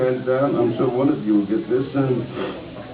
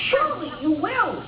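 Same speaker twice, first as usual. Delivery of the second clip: much higher hen voice; little red hen. Acted. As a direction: squeak it up high as the hen.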